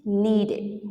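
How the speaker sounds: "needed" as two syllables, ending id; careful model of "needed" with id ending; extra id syllable. Shown as a demonstration. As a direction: say "needed" like need-id. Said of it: "the ending sound is ed".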